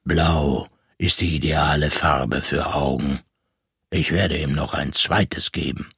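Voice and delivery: low, firm voice deciding calmly; deep serious voice. A narrator's reading. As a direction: drop it low and sure of itself. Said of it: "Die Stimme war tief und ernst".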